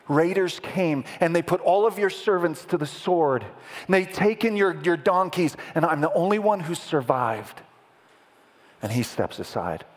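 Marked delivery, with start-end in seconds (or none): 0.1-7.6 higher-pitched, pressing report of disaster; urgent messenger. Acted. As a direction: deliver the bad news in a rush of alarm. Acts servant bringing terrible news.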